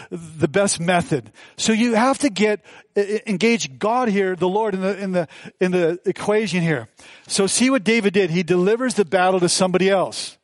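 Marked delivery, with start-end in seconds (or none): none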